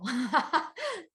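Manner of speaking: high, light laugh; amused laugh. Real, not acted. Amazed by the butterfly sign, high amused laugh.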